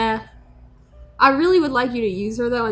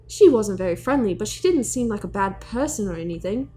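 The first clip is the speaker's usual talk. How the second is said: usual speaking voice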